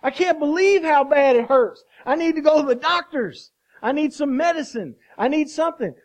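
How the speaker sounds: loud, high-pitched cry of acted hurt; hammed-up pained outcry, pleading for help. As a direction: wail it like someone badly injured. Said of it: "You cried out".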